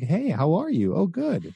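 high, rising nervous tone; rapport-breaking upspeak. Shown as a demonstration. Coach showing nervous guy's high rising upspeak. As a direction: lift every ending up like asking permission. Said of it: "not talking like this".

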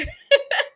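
high squealing laugh. Real, not acted. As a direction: burst out laughing. Laughs at the scene.

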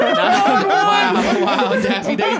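high, breathy squeal of disbelief; squealing wow. Real, not acted. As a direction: squeal it in mock amazement. Mock awe at Daffy Duck.

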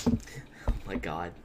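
slow, soft whispered exclamation; disbelieving whisper. Real, not acted. Co-host reacts to friend's YouTube ban, whispering in disbelief. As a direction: whisper it under your breath, drawn out.

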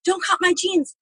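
high, startled cry of alarm; shrill panic on waking in the hospital; panicked shock. Retold or imitated. Waking up to staff cutting her jeans, panicked high cry. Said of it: "I'm like".

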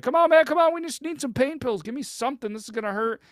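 high, loud, urgent begging; desperate pleading. Retold or imitated. Injured climber begging the doctors for pain pills, high loud pleading. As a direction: beg the doctor with your voice way up. Patient begging before foot reset.

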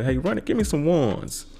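grandma Ethel asking Ronnie for cash, playful high voice; lightly voiced granny. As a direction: ask for the bills like a giddy grandma. Grandma asks for dollar bills.

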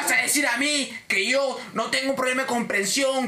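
high, indignant tirade with drawn-out insults; outraged customer. Retold or imitated. Cliente que insulta al agente, indignado. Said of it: "el huevón ahí voló".

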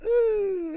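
high, soft drawn-out "ooh"; thrilled ooh. Real, not acted. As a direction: coo it high in delighted awe. Awed reaction to God's work.

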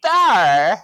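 sudden high shout of discovery; excited shout. Retold or imitated. Paige pointing out the portal, loud shout. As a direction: yell it out as you spot something.

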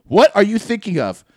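loud, high mock interrogation; angry demand. Offended friend pressing the laugher, loudly demanding. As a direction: repeat the question forcefully, acting fed up. Same demand repeated louder.

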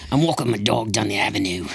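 a hint of English tough-guy Statham accent; lightly put-on Statham impression. Podcast friend takes his turn at a Statham accent, lightly voiced. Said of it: "Your best Statham accent".

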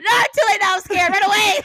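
high, loud laughing panic; panicked shout. Retold or imitated. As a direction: yell it in mock alarm. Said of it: "I was like".

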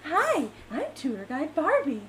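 lightly voiced perky guide, slow; a weaker copy of the doll guide voice; Tour Guide Barbie voice. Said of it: "You still don't do it as good".

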